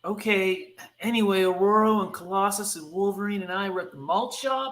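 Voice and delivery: put-on high-pitched girl character voice; a kid recounting events, pitched high; young Kitty. Girl explaining to her rescuer, young high voice.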